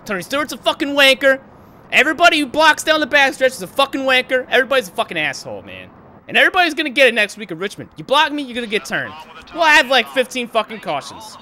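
high, loud heated tirade; angry rant. Real, not acted. Sim racer ranting at blocking drivers, heated. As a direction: let it all out, fed up.

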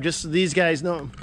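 normal talking voice

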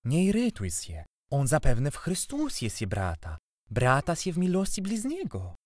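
high-pitched, indulgent aunt voice; kindly aunt. A narrator's reading. Aunt calming irritated uncle, kindly and high. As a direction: soothe him in a lifted, sweet tone. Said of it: "rzekła dobrotliwie ciocia".

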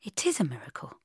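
hushed, near-whispered admission; soft. A narrator's reading. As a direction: breathe it quietly, almost whispering. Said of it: "he said softly".

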